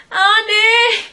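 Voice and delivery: high, loud cry of a name; high yelled call for Andy. Acted. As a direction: shout the name out high and desperate. Brooklyn calls out for Andy.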